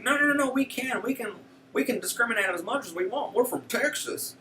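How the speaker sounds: mocking Texas lawyers with an exaggerated Texas accent. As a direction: play Abbott's lawyers in a bad, squeaky Texas accent. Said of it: "my Texas accent is probably atrocious".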